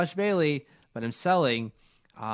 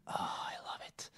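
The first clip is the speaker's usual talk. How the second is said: whisper; 12 dB quieter, much faster than usual. Real, not acted. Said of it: "whispered aside".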